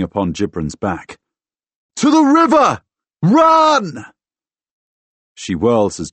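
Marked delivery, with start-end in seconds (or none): 2.0-4.1 very high, loud shouted orders; bellow. A narrator's reading. Fighter leaps on attacker and sends friend to the river, bellowing. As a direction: yell at full force to send someone fleeing. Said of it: "I bellow at Afia".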